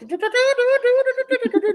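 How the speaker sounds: high, fast hummed Benny Hill tune; mock Benny Hill chase tune. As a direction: hum the chase music, loud and high. Benny Hill music cue.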